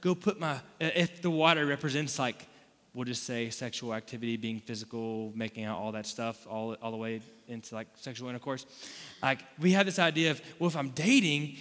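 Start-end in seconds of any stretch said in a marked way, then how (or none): none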